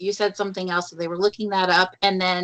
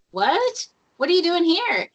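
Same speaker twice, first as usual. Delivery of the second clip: surprised; 9 semitones higher than usual. Retold or imitated. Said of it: "I'm like".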